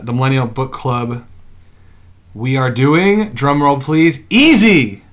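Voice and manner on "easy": higher, louder title announcement; big reveal. Real, not acted. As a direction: punch out the title after a drum roll. Title reveal after a drum roll.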